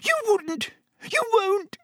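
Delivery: very high, squealing disbelief; gasped in terror. A narrator's reading. Pettigrew facing death from old friends, terrified gasp. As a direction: gasp it out in panic. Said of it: "gasped Pettigrew".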